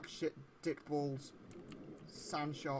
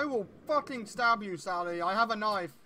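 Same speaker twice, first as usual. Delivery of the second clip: louder, hammed-up menace with a grin; mock threat; 5 semitones higher, 7 dB louder than usual. Player threatens a sea creature, hammed-up menace. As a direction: play the tough guy half in jest.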